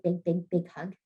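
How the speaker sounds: ordinary talk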